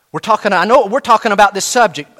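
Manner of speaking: ordinary talk, nothing marked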